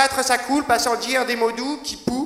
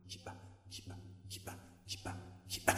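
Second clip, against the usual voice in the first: whisper=43; 22 dB quieter, faster than usual. Acted. Whispered refrain in poem.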